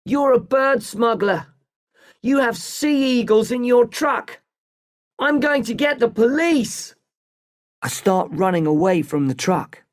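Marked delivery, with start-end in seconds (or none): 0.0-6.9 high-pitched accusing cry; screaming. A narrator's reading. Boy threatening the smuggler with police, screaming. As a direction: call out the accusation, pitched well up. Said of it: "I scream at him".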